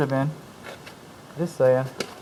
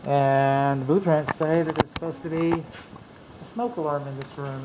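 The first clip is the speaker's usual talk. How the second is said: ordinary talk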